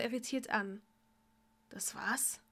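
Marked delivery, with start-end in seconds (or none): 1.7-2.4 whispered question. Acted. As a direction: ask in a stunned whisper. Draco asks after spell.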